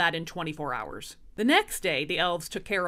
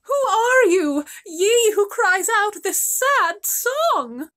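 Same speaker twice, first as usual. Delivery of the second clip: high, slow, far-off calling maiden; slow, high and lilting; lofty maiden voice; 11 semitones higher, 5 dB louder, slower than usual. Acted. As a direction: call out like a fairy-tale princess. Maiden calls to hidden crier.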